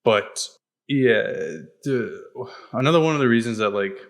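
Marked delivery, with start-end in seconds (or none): none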